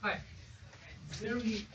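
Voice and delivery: ordinary delivery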